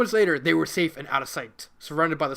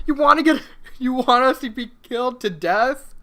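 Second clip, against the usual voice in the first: louder, higher exasperated rant; exasperated barking; 6 semitones higher, 6 dB louder than usual. Acted. Mac snaps at Mega Man, exasperated. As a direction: scold in disbelief. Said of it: "Mac barked".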